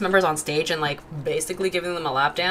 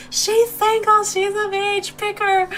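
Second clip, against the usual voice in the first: mock scream, much higher and louder; imitated screaming; 4 dB louder, slower than usual. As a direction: imitate a friend screaming to get someone picked. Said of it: "screaming, pointing at me".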